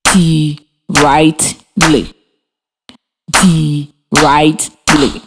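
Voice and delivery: very slow, one beat per syllable; Directly stretched out slowly, each syllable separate; clapped syllables. Shown as a demonstration. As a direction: split directly into di, rect, ly. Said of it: "while I clap the syllables in the word".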